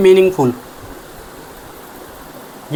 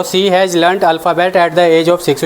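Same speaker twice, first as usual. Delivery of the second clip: dictation pace; much slower than usual. Shown as a demonstration. Teacher dictates corrected sentence.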